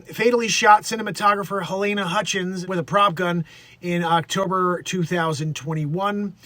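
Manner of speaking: ordinary talk, nothing marked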